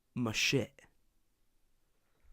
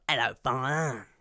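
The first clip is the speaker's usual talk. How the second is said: loud, hammed-up East London villain; imitated villainous son; 6 dB louder than usual. Podcaster contrasting son with father's accent, hammed-up villain. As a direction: greet in a theatrical put-on villain voice. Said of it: "And then his son's like".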